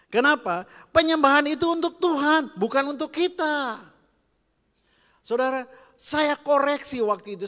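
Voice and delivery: high, loud preacher's call to the congregation; full-force preaching. Acted. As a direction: preach it out with fire.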